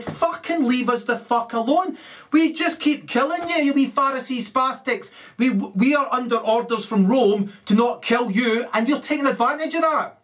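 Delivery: high-pitched, fed-up mock outburst; exasperated rant. Roman soldiers telling off people they cannot punish, exasperated rant.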